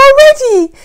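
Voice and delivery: high, loud, slow astonishment; husband amazed it happened so soon; amazed disbelief. Retold or imitated. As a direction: marvel at how fast it happened. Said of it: "He was like".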